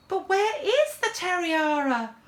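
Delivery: high-pitched diva in alarm; panicked, dramatic diva voice; distressed diva. Acted. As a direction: cry out in theatrical dismay. Eva discovers her tiara gone.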